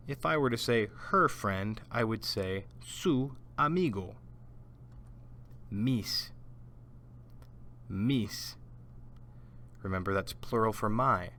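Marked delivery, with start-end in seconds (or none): 5.7-8.6 Mis said alone in Spanish with a clear s. Shown as a demonstration. Teacher says Mis for students to repeat. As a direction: model Mis crisply. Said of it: "repeat after me".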